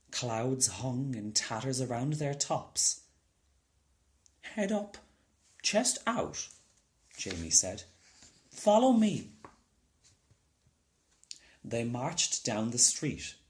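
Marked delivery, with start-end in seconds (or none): 4.4-6.5 put-on much higher, bright boy calling orders; a little boy playing parade leader; boy's marching orders. A narrator's reading. Jamie gives marching orders. 8.5-9.3 much higher, louder call to follow; rally the troops like a proud little boy; boy's rallying call. A narrator's reading. Jamie leads the parade.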